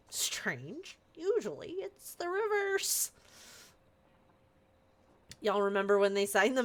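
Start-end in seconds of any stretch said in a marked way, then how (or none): 0.1-3.1 put-on slow, dry old-woman voice; deadpan grandma delivering a zinger. Quoting the Croods, she does Gran's slow, dry reply. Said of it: "And Gran's like".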